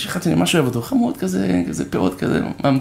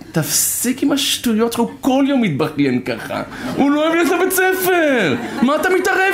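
higher, laughing mock-indignant wife; scolding wife; 8 semitones higher than usual. Wife telling her husband to stop meddling, higher and laughing. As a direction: tell him off for meddling, half laughing.

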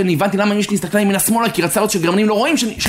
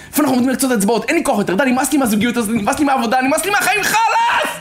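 fed-up outburst; 7 semitones higher, faster than usual. Acted. Voicing someone who is fed up.

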